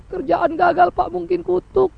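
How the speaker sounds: complaining believer pleading with the pastor; whining congregant. Retold or imitated. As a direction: moan about the sickness, suspecting a curse. Congregant blames failure on curse.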